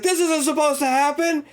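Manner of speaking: high-pitched imitation of panic; panicked cry. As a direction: play someone losing control of the situation. Retold hypnotist panicking.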